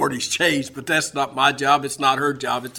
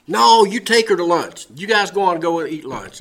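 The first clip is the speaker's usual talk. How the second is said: loud, raised-pitch insistence; insistent order; 5 semitones higher, 7 dB louder than usual. Retold or imitated. Husband sending his wife out to lunch, insistent order. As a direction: wave off the objection forcefully.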